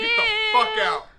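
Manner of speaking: outraged cry. Real, not acted. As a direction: cry out in disbelief. Outraged at insult to dog.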